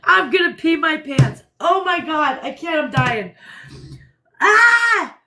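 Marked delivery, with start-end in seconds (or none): 4.4-5.2 a high loud shriek of laughter. Real, not acted. Shrieking with laughter at what she just saw. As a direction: let out a real laughing shriek. Cracking up at a video.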